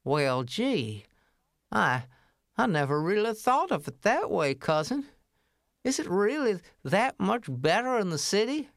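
a hesitant, folksy little mouse voice; gee-whiz country cousin, unsure. Acted. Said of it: "and he said".

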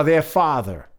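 plain reading voice

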